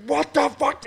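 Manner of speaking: high, loud mock curse at the sled; furious outburst. Retelling dad snapping at the broken sled, furious mock yell.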